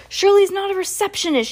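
put-on high, loud child's outburst; outraged cry. A narrator's reading. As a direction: cry it out in high-pitched protest. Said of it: "she cried".

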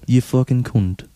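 slow mock British swearing; British accent. Shown as a demonstration. Host feeding a friend a British insult, slow British accent. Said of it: "blast off on a British accent".

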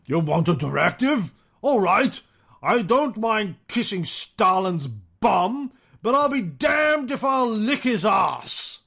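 hammed-up wartime leader, drawing out the words; Churchill. Host reads Churchill's message to the ambassador, hammed-up impression. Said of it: "can't remember my Churchill voice".

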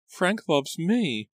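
high, slow, distressed protest; trembling cry. A narrator's reading. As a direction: drag it out in anguish. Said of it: "she cried in a trembling voice".

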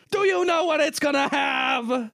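wrestling promo. Acted. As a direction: yell it like The Rock. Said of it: "you're cutting a promo on me pretty hard".

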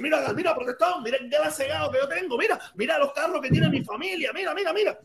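lightly voiced show-off; show-off bragging. Imitating a show-off cousin.

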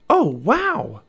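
high, loud wonder; amazed. A narrator's reading. Annie sees over the hill, amazed. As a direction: exclaim in swooping astonishment.

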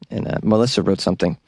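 ordinary talk

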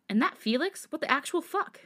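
half-gasp the swear in outrage; shocked outburst. A narrator's reading. Friend hearing of the cheating, shocked and outraged. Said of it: "Hyunjin gasped in shock".

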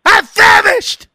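high, loud, slow whiny shout; husband bellowing at wife for dinner, mock whiny shout; hammed-up demanding husband. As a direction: yell for your dinner like a spoiled husband. Imitating Sam demanding food.

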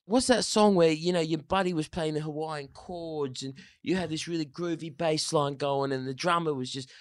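put-on mild English accent. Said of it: "that's how he sounded".

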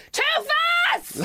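sudden high yelled warning; startled high scream from the passenger seat; panicked shout. Retold or imitated. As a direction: bark it out at the oncoming car. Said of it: "Like, shouting".

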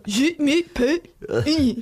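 exaggerated French accent, high and slow; hammed-up French teacher, loud and drawn out; teacher's French accent. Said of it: "And the teacher would go".

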